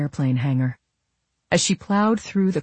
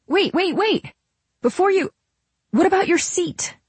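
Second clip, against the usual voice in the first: high, urgent, pleading voice; very high-pitched, rushing to stop someone; Camille. A narrator's reading. Camille stopping Nola as she leaves, high and urgent.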